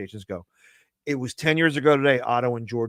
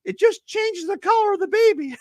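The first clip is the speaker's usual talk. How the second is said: much higher cute dummy voice; squeaky puppet voice; 3 dB louder than usual. Acted. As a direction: chirp it like a tiny cute puppet. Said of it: "I say the next one".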